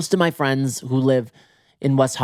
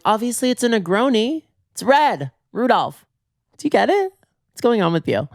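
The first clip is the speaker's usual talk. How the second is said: high-pitched mock exasperation; sassy; 9 semitones higher than usual. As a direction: act like the answer is obvious, pitched up. Sassy explanation to bartender.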